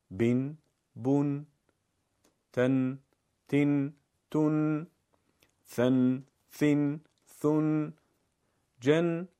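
each syllable said alone with an, in, un endings; drilling tanween sounds. Shown as a demonstration. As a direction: call out each letter's three tanween endings. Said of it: "با سه نوع تنوین بخونیم". (هر حرف را جدا با «ان، این، اون» تمرین کن)

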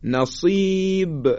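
نصیب drawn out, final ب echoing; slow recitation demo. Shown as a demonstration. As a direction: stretch نصیب, bounce the ب. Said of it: "حرف با".

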